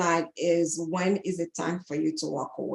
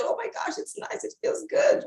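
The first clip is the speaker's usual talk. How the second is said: gushing. Retold or imitated. Mocking a smitten partner.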